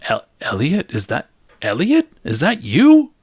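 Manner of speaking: rising from a bare question to a high, loud cry of disbelief; shocked recognition. Acted. Karen recognizing her disguised ex, climbing to a shocked cry. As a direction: let the shock climb until the last words burst out high. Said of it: "she's like".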